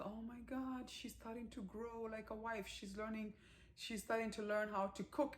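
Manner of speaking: high, hushed, amazed murmur; husband marvels quietly to himself at his wife learning. Retold or imitated. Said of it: "Rishu being like".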